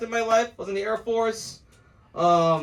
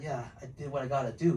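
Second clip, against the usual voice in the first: low-voiced ex-con; 10 semitones lower, 8 dB quieter, faster than usual. Friend hinting about prison deeds.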